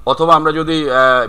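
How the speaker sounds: ordinary talk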